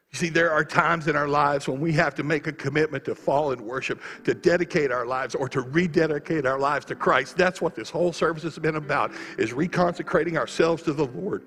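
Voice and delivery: loud, high, driving preacher over the music; preaching at full force. Acted. Preacher urging the congregation to rededicate, full force.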